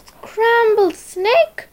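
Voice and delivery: high, slow, shocked snake voice; alarmed snake. Acted. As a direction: gasp it out like a startled snake.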